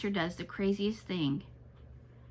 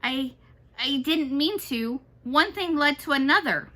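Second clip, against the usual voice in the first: stammering; 5 semitones higher, 5 dB louder than usual. A narrator's reading. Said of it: "She stammers".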